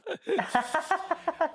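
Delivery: very high burst of laughter. Real, not acted. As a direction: break up laughing. Laughs at own joke.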